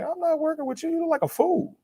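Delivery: very high falsetto mimicry; mocking quote. People refusing to work with someone who looks crazy, high mock voice. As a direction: squeak out a dismissive brush-off in falsetto.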